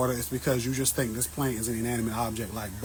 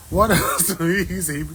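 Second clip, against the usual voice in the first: laughing while reading; 5 semitones higher, 7 dB louder than usual. Real, not acted.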